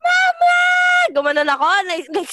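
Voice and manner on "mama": high, loud yell of Mama; shouting for mama. Retold or imitated. Child yells for mama at the neighbor's gate, loud shout. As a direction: belt it out as a kid calling mom. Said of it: "sumigaw ako".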